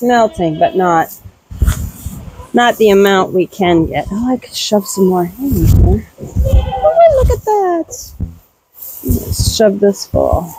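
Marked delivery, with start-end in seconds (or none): 6.2-8.1 surprised oh. Real, not acted. Noticing something in barn.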